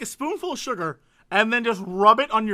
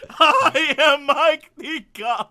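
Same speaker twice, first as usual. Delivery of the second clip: slow, louder, laughing Chicago Ditka voice; Chicago accent; 5 semitones higher, 6 dB louder, much slower than usual. Retold or imitated. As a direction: boom it out as Mike Ditka. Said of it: "how bad my Chicago accent is".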